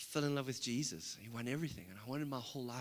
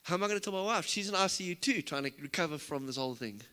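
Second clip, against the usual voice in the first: retold panic; 4 semitones higher, 6 dB louder than usual. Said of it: "And I'm freaking out".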